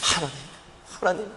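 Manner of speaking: soft tearful calling; quietly calling God through tears; crying out to God. Retold or imitated. A lonely believer weeping at the altar, soft crying call. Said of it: "하면서 우는데".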